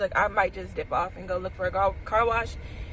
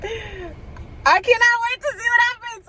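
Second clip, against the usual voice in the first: high, slow, nervous giddiness; barely contain the thrill; giddy excitement; 12 semitones higher than usual. Real, not acted. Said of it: "I'm so nervous".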